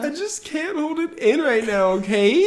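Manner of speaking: laughing through tears, high and tearful; tearful laugh, voice breaking up; crying. Real, not acted. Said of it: "He's kind of crying right now".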